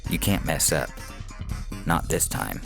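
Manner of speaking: ordinary delivery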